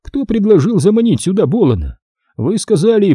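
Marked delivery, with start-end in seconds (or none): 0.0-2.0 high-pitched challenging question; accusing. Acted. Major blaming Nick for the plan, accusing. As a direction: throw the question back as an accusation.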